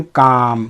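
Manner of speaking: काम as "kaam", no l sounded; silent l. Shown as a demonstration. Teacher modelling calm with silent l, काम said "kaam". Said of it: "यहाँ पे एल जो है ना silence हो गया है".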